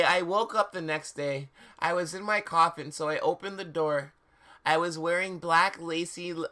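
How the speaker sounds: ordinary delivery, nothing marked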